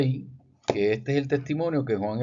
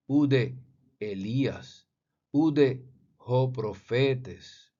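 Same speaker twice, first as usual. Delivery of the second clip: slow, deliberate reading of ancient Greek; slower than usual. Shown as a demonstration. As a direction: sound out each Greek word carefully. Reads Greek text slowly.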